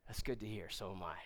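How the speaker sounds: low, quiet confidential reply; officer softening. Retold or imitated. Commanding officer admits shared faith to trainee, low and quiet. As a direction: drop right down, as if letting him in on it.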